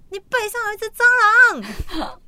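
yelling a warning across the night market; sister's alarmed shout. Retold or imitated. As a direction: shout it like a panicked little sister spotting a bug. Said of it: "我妹就突然间大叫说".

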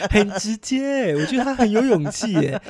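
high giggly laugh through the words; talking through delighted laughter. Real, not acted. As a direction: laugh it out, amused. Laughing while retelling the pickup.

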